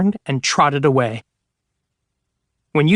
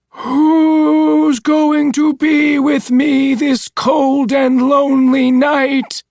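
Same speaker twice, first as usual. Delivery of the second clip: much higher, louder, very slow wail; loud moaning ghost; 12 semitones higher, 6 dB louder, much slower than usual. Acted. The voice nearly upon her, loud slow wail. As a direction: wail it right up close. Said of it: "heard the voice even closer".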